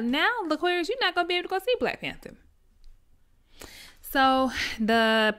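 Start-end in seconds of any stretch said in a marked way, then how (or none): 0.0-2.3 higher and fast, a scolding grown-up; stern parent voice. Retold or imitated. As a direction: rattle off the warning like a strict mom. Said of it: "And I'm just like".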